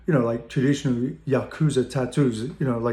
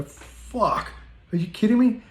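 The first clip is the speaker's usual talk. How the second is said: breathy gasp rising into a much higher squeal; jaw-drop shock at the cash; stunned disbelief; 9 semitones higher, slower than usual. Retold or imitated. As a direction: whisper the swear, then shriek the question up high. Shocked at bag full of cash.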